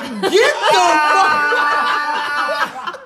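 high, hugely drawn-out outraged yell; hammed-up furious shout, stretched way out; mad, imitated. Woman reacting to being handed the check, hammed-up mad shout. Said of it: "She's so mad".

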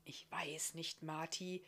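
soft, private whisper; whispered answer. A narrator's reading. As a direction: whisper hesitantly to your son. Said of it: "auf das Flüstern antworten".

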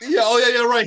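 loud high cheering; excited. Real, not acted. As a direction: whoop it up, thrilled. Celebrating hit, big jump.

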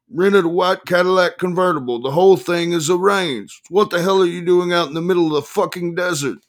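high-pitched shouted tirade; irate attorney. A narrator's reading. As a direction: berate your client, pitch held high.

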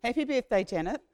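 ordinary delivery

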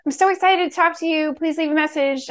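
high, cheerful recorded message; happy voicemail. Shown as a demonstration. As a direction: gush it out like an island girl's welcome. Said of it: "my happy voicemail".

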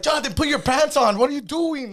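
high, loud scolding shout; mock yell. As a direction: yell it like a fed-up friend reining him in. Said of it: "Raul's there like".

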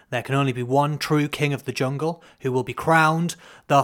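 booming trailer-style intro read; announcer voice. Acted. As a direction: announce it like a show opener. Produced show intro.

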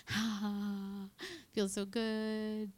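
a slow, lightly voiced sigh of bliss; mock contentment. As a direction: savour it slowly. And you're like.

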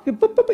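high boop boop boop; mimicked keyboard beeps. Pastor mimics the hotel keyboard, high beeping. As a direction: beep like the clerk's keyboard.

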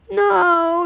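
high, loud wail of dismay; monster's groan. A narrator's reading. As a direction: groan it out in loss. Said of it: "he groaned".

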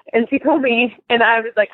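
usual speaking voice

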